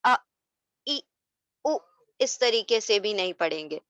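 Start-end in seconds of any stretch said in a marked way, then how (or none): 0.0-1.9 ا، ای، او each cut off with a jolt; abrupt, separated ا، ای، او as the mistake; jerked vowels. Shown as a demonstration. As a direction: jerk each of ا، ای، او short. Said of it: "اس کو جھٹکا نہیں دیں گے".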